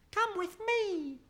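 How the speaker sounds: very high, slow little creature voice; a tiny octopus inviting her along. A narrator's reading. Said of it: "he said".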